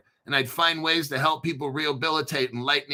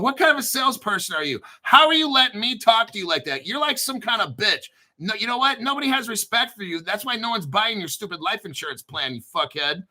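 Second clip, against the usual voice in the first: mock rant at caller; 6 semitones higher than usual. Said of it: "take out whatever kind of anger".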